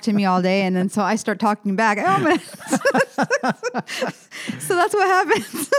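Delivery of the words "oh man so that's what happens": breaking into high laughter; real laughter through the words; laughing. Real, not acted. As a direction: laugh it off, amused at yourself. Laughing at her own accent.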